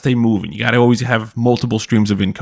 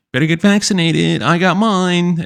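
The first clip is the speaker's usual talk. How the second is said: mocking smug girls; 7 semitones higher than usual. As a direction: drag out the smug brag in a mocking higher voice.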